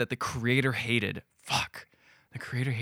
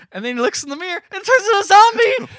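much higher, louder climactic telling; excited build-up; 9 dB louder than usual. Real, not acted. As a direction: hit the twist with rising glee. Telling the twist.

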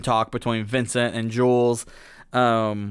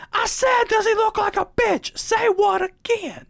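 much higher, strained mock shout. Jules screaming at Brett, hammed-up shout. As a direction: scream it in his face.

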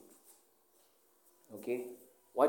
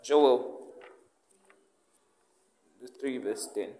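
normal talking voice

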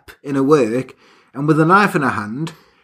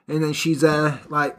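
ordinary talk, nothing marked

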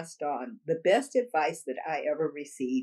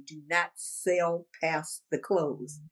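slow, emphatic; 3 semitones lower, much slower than usual. Real, not acted. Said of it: "I try to share that".

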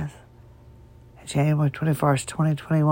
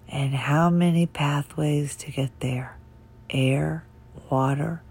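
whisper. Real, not acted. Voice fading while explaining.

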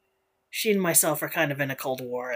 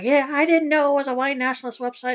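high, quick mock-innocent voice; mocking the girlfriend; 9 semitones higher, 5 dB louder, faster than usual. Mocking her excuse about the website in a high, fast voice. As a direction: play dumb in a higher, faster voice. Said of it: "but she was like".

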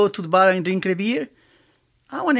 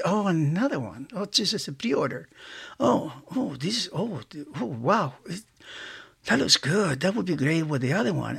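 hushed, half-whispered inner monologue over a toy; muttering to himself; 3 semitones lower, 9 dB quieter than usual. Retold or imitated. Collector eyeing a pre-order, softly muttering to himself. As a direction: mumble your shopping thoughts under your breath. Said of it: "that's the way I talk".

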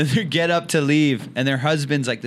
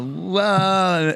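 high, long re-enacted whoa; imitated stunned holler; drawn-out whoa; 7 semitones higher than usual. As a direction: call it out high over a commotion. His calling out over the fight.

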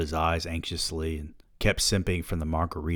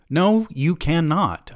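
slow, high and loud, a flat no; firm refusal; 11 semitones higher, 5 dB louder, slower than usual. Acted. Father refusing his son, slow firm no. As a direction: shut the kid down, word by word.

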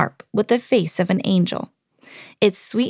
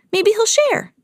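high squeaky cartoon mouse voice; Mickey's voice; 12 semitones higher than usual. Mickey to his friends, a high hopeful squeak. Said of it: "he said".

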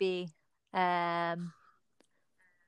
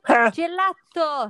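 Italian pronunciation; 4 semitones higher, 6 dB louder, much slower than usual. Real, not acted. Italian answers quiz in Italian.

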